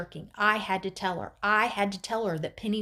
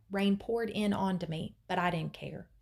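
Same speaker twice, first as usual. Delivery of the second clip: usual speaking voice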